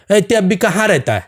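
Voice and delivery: the question "এই তুই আব্বি কাহা রেতা হ্যায়?" asked in casual Hindi, not Bengali; a friend asking in everyday Hindi; switching to Hindi. Retold or imitated. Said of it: "যে আমার বন্ধু সে আমারে জিজ্ঞাসা করে". ("আব্বি কাহা রেতা হ্যায়" হিন্দিতে সাধারণভাবে জিজ্ঞেস করা)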